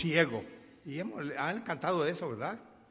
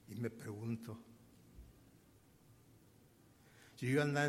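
low, soft, heartfelt preaching; grateful and subdued, with long pauses; hushed reflection; 5 semitones lower, 8 dB quieter than usual. Real, not acted. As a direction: drop to a quiet, sincere musing.

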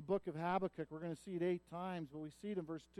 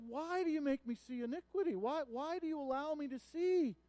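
high, loud anguished plea; anguished lament; 8 semitones higher, 4 dB louder than usual. Acted. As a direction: cry out in grief to God. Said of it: "then he goes".

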